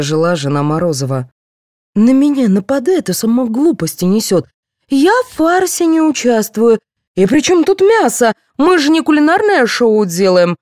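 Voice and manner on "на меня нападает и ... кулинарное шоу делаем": high-pitched, aggrieved protest; indignant. A narrator's reading. Secretary defending herself before the cast, indignant. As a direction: protest hotly, pitch climbing. Aida attacks in a quarrel.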